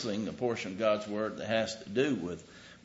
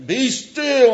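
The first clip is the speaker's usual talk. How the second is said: dramatic shouted command; 12 semitones higher, 12 dB louder than usual. Shown as a demonstration. The wrong way Jesus calmed the storm, bellowed at the waves. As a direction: bellow it high and loud, arms flung wide. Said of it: "raising his voice or waving his arms".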